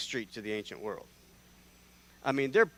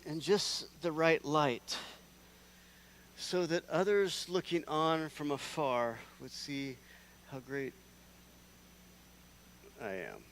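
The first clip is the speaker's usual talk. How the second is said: fairy-tale telling; 3 semitones higher, slower than usual. Acted.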